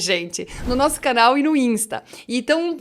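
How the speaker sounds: ordinary talk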